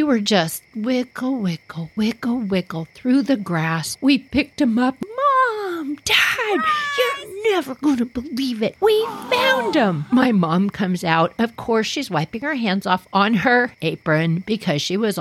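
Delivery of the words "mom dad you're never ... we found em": excited shout. Retold or imitated. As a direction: yell the good news with joy. Kids shouting the good news.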